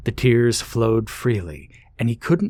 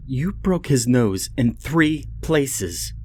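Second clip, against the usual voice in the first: slow, raised scolding screech; 5 semitones higher, slower than usual. Acted. Penny scolds Ben over the broken nose, raised slow screech. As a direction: scold him, drawing out each word. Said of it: "Penny screeched".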